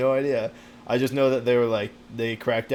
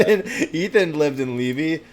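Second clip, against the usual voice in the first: laughing; 5 semitones higher, 6 dB louder than usual. Real, not acted. Laughs telling about Ethan.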